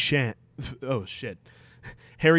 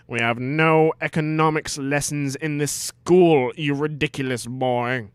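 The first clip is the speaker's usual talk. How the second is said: mock scolding teacher, louder; 2 semitones higher, 7 dB louder than usual. Snape scolding Harry.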